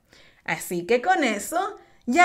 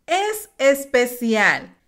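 ordinary delivery

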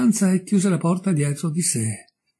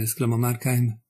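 usual speaking voice

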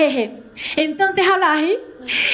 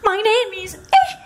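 much higher, laughing pop-star imitation; 6 semitones higher than usual. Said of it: "j'ai appris l'anglais avec [name]".

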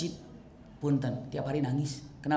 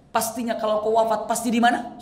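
normal talking voice

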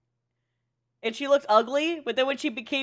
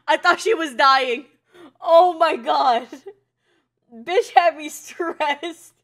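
normal talking voice

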